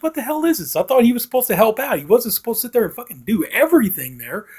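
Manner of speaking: high-pitched, exasperated griping; indignant. Real, not acted. Reviewer objecting that Woody does all the work, indignant. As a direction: protest on his behalf, pitch jumping up.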